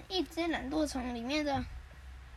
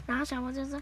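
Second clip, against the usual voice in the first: plain reading voice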